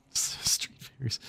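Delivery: hushed whisper of the repeated words; whispered aside. A narrator's reading. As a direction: drop to a secret whisper. Narrator whispers repeated phrase.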